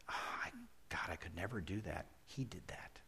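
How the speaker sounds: near-whisper, trailing very soft; hushed, breath-only wonder; hushed awe. Real, not acted. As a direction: drop to an overwhelmed whisper. Awed at God giving his Son.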